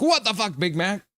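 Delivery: high, startled what the; shocked outburst. Retold or imitated. Streamer quoting a chatter's shocked reply. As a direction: blurt it out in disbelief. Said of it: "Big Hog's like".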